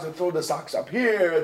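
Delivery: ordinary talk, nothing marked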